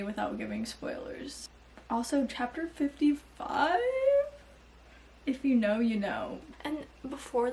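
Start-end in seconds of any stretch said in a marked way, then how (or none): none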